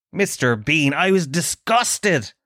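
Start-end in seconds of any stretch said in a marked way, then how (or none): none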